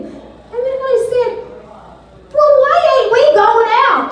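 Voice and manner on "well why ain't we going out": sudden high loud blurt; blurted question. Retold or imitated. Woman blurting out a date request to a coworker. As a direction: blurt it out, way too eager.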